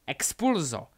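sudden loud incantation; shouted spell. Acted. Delphi blasts the floor under Harry, shouted spell. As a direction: fire the spell off loudly.

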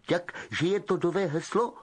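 lightly voiced spoilt boy; Malfoy asking Harry the password.

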